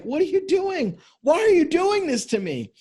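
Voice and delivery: high, pleading cry; pained plea. Retold or imitated. Jilted nerd begging his girlfriend in the car, high pained plea. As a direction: plead with her, voice jumping up high. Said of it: "And in that pain".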